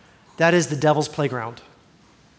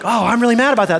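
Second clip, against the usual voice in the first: higher and louder, a playful show of anger; mock mad; 5 semitones higher, 6 dB louder, faster than usual. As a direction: get worked up for the joke. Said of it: "and then I'm like".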